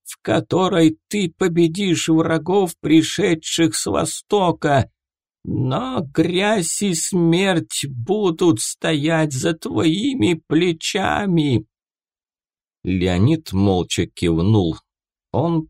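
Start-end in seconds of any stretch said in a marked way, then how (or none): 0.0-11.6 high, slow shrieking oracle; shrieking prophetess. Acted. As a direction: shriek it like a possessed seer. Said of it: "взвизгнула Пифия".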